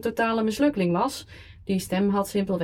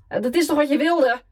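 put-on higher, loud drunken shout; 7 semitones higher, 6 dB louder than usual. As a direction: yell it down the phone. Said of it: "schreeuwde ik".

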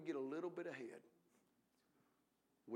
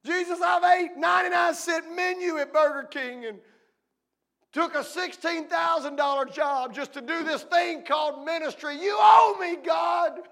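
much higher and much louder, ranting at God; mock demanding shout; 13 semitones higher, 19 dB louder than usual. Preacher voicing a minister who thinks God owes him, shouted. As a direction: yell it like an entitled complainer.

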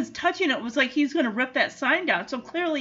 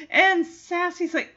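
usual speaking voice

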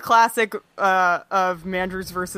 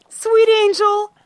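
high, slow doting coo; 11 semitones higher, 4 dB louder than usual. Acted. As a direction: coo it like a proud parent. Cooing over wrestler.

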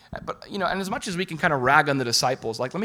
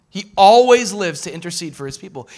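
louder, stressed "always"; emphatic repeat; 2 semitones higher, 10 dB louder than usual. Real, not acted. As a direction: proclaim it with fervor.

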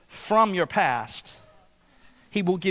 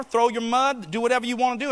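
usual speaking voice

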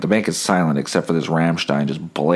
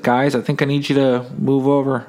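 a higher, put-on official voice asking them to move; security guard; 8 semitones higher than usual. Security guard asks loiterers to move, put-on polite voice. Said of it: "Security was like".

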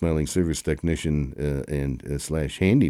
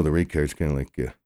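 normal talking voice

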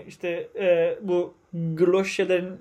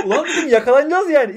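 laughing; 6 semitones higher, 7 dB louder than usual. Real, not acted. Laughing while telling story.

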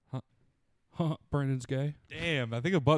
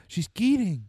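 higher, louder mimicked movie line; imitated movie gangster; 7 semitones higher, 5 dB louder, slower than usual. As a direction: mimic the tough guy's accent. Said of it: "And then he's like".

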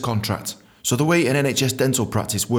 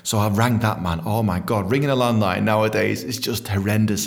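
ordinary delivery, nothing marked

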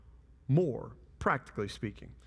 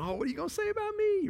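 very high, quick comic voice; mock self-centred; faster than usual. Self-centred listener asking about me, high and mock-eager. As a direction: pipe up eagerly, all about yourself.

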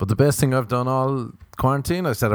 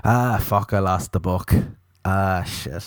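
mock dismay; 2 semitones lower, slower than usual. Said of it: "They'll just hope the book gets lost".

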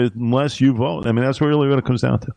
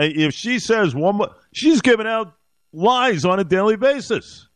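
raised, heated pitch, slowing on the accusations; indignant rant; 7 semitones higher than usual. Real, not acted. Radio host venting about the majority leader, heated and indignant. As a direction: climb up in pitch and lean into the outrage.

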